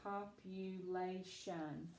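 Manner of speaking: slow, soft, low "Population", syllable by syllable; careful word reading. Shown as a demonstration. Teacher sounding out Population for a student, careful word reading. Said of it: "Accent is right here".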